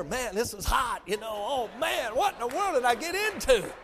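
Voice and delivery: acted pepper-burn fuss building to a whoop; mock panic. Imagined husband who bit a hot pepper, hammed-up alarm. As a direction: start agitated, climb in pitch, end in a loud "woo". Acts out man bitten into pepper.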